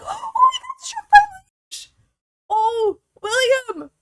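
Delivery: gasp, then a high, loud wail of dismay; shocked gasping cry. Real, not acted. Gamer gasps as her sim William is struck. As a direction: cry out in shock as lightning hits.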